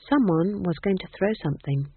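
usual speaking voice

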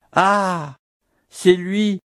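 une voix plus haute, joyeuse et émue; elle le reconnaît et s'écrie; cri de joie. A narrator's reading. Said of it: "s'écria-t-elle". (Mathilde s'écrie en revoyant le prieur, plus haut)

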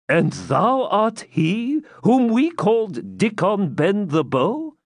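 high, slow incredulous question; astonished recognition. A narrator's reading. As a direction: draw out the dawning recognition. Isaac astonished at recognising outlaw.

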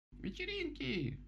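very high, stretched-out squeal. A narrator's reading. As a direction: squeal it slowly like tiny excited animals. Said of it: "пискнули крот, мышка-малютка и полёвка". (восторженный писк зверят)

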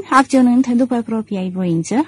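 ordinary delivery

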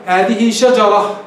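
هذه شجرة slow, ة sounded as h; pausal ha. Shown as a demonstration. Teacher demonstrates stopping on the word, pausal h. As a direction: stop slowly on شجرة with h, no t. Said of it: "قف عليها".

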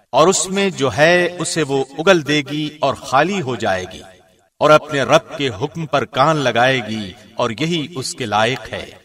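ordinary talk, nothing marked

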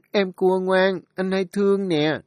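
put-on older sister sweetly praising the baby; child's cooing.

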